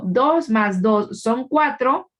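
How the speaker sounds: slow, deliberate "Dos más dos son cuatro" with más said clearly; teacher slowly reads out the example sentence. Shown as a demonstration. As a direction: spell the example out word by word, slowly. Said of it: "Pero si yo digo".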